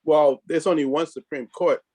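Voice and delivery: ordinary talk, nothing marked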